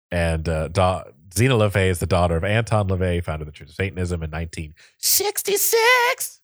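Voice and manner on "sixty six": hammed-up high shout; mock shout. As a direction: belt the number out as a joke. Shouts the number as a joke.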